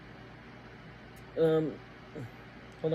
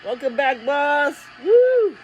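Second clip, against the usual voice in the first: raised-pitch shout ending in a high woo; excited welcome cheer; 9 semitones higher, 6 dB louder, slower than usual. Real, not acted. Fan welcomes the boss back on air, excited cheer. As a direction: cheer them back, pitch climbing into a whoop.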